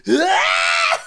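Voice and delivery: high, loud imitated battle scream; shrieked mock battle cry; war cry. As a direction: mimic a little girl's furious war cry. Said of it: "like this war cry".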